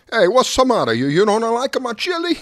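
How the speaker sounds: loud, high Italian uncle voice; Italian accent. Retold or imitated. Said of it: "he had this heavy Italian accent".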